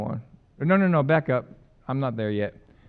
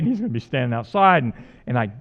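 ordinary talk

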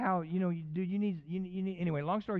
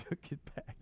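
laughing breathily; 2 semitones higher, 11 dB quieter than usual. Real, not acted. Laughing at the story's end.

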